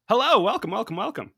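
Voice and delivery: loud, high, bouncy welcome; high energy greeting. Acted. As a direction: burst in with big host energy. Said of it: "I can't stay at that low energy".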